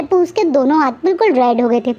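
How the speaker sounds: usual speaking voice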